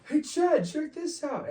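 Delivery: egging him on like rowdy buddies; hyped friends. Retold or imitated. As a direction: friends hyping up Chad, high and loud. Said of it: "they're like".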